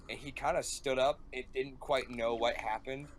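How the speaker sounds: usual speaking voice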